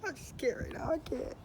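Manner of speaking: high-pitched mock tearful whimper; hammed-up, too choked up to go on; pretend crying. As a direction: fake being overcome with tears. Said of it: "I just had to go cry".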